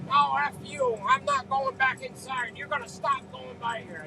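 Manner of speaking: a retold angry refusal, lightly voiced; heated refusal. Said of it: "Oh boy, he got hotter".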